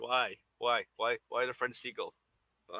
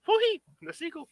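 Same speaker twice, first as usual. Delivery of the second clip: much higher, louder, laughing through the name; high laughing name call; 6 dB louder than usual. Shown as a demonstration. Naming the seagull to viewers, high and laughing. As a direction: giggle out the seagull's name, pitched up. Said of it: "call him Foodie".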